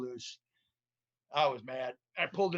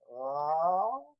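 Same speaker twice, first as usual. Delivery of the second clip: imitated detector tone; 3 semitones higher than usual. Imitating machine sound.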